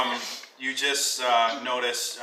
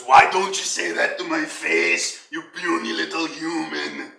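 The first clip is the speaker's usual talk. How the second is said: taunting brute; 4 semitones higher, 6 dB louder than usual. Acted. NPC Shield Basher taunts player.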